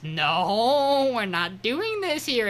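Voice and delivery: high, long wailed "no"; hammed-up refusal. Podcaster acting out her refusal to do this here, high drawn-out protest.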